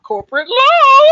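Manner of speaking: high, loud, slowed impressed echo. Real, not acted. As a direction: sound impressed by the answer.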